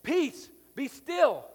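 booming slow decree; loud proclamation. Retold or imitated. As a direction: proclaim it to calm the storm. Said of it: "in a loud and proclamation way says".

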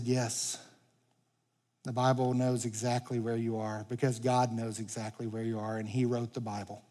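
soft, low, tender reply; gentle reassurance. Retold or imitated. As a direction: softly reassure a hurting young woman. Tender answer to a crying woman.

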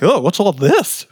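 mock alarm, pitched high; startled discovery. Adam and Eve noticing their bodies, mock startled. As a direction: act out a comic, high-pitched startled reaction.

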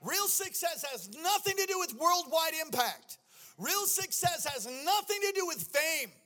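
loud, high, sing-song preaching; preaching at full force. Acted. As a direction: build it like a revival climax. Climactic preaching with music.

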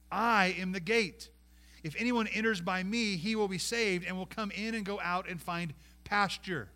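ringing reading of Jesus' words; scripture reading. Acted. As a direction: proclaim it to the congregation. Reads Jesus' words in raised voice.

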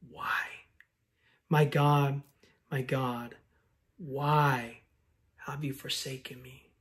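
whispered why, then a soft, fading plea; hushed cry from the cross. Acted. Preacher voicing Jesus on the cross, whispered and fading. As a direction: let the words die out like a last breath.

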